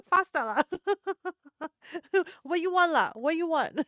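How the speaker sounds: imitating a brisk Singaporean auntie; thick Singaporean accent. Vlogger imitates thick Singaporean accents she finds funny. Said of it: "thick Singaporean accents".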